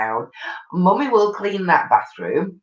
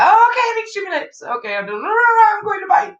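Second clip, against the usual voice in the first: much higher and fast; squeaky rushed panic; hammed-up high-pitched rush; mock hurry. Mimicking friend rushing.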